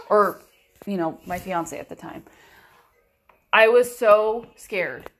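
ordinary delivery

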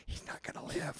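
hushed, breathy aside; whisper. Retold or imitated. Onlookers whispering about the injured player, hushed. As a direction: whisper it so the player can't hear. Onlookers muttering grim verdict.

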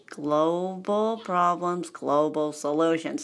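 very slow, drawn-out title; slow self-reminder. Real, not acted. Speaker pulling self back on topic, drawn-out delivery. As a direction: stretch out each word to refocus.

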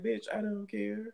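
high-pitched, unbothered retort; dismissive comeback. Retold or imitated. Friend quoting her comeback to excuse-makers, high and dismissive. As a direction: brush them off in a high voice.

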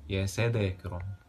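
storyteller's formal opening; arabic cafe storyteller. A narrator's reading. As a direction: open the tale like a cafe raconteur.

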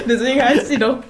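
cracking up at a pun; real giggling fit mid-sentence; laughing. Real, not acted. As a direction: talk through high, loud laughter. Cracks up at Blase/Base wordplay.

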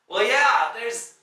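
irritated host talking over the noise. Acted. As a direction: raise your voice in irritation. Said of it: "kind of speaking louder".

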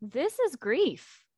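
slow, halting realisation of loss; dawning grief. Retold or imitated. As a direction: let each word land as the grief sinks in. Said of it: "Thanatos is like".

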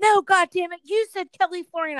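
high, loud retold fury; irate dad. Dad arguing with the fake agent, high and irate. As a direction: burst out, insisting you heard right. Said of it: "he's freaking out".

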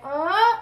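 loud, high rising "uh"; imitated friends' reaction. Friends reacting to the young guy, loud imitated noise. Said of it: "All my friends were like".

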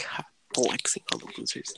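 smug whisper. Pretend. Mock smug phone voice.

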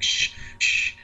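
retold sharp shush; urgent shushing. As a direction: shush her so nobody wakes. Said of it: "we can't wake anybody up".

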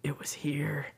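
imitated whisper into a coworker's shoulder, unvoiced. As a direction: whisper it secretly, like a confession. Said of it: "leans into her shoulder and is like".

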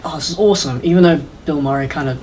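ordinary talk

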